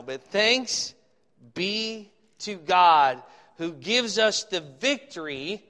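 loud, slow, declaimed Bible verse; scripture reading. Acted. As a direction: proclaim it with ringing emphasis. Reads scripture aloud.

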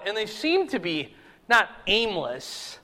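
ordinary talk

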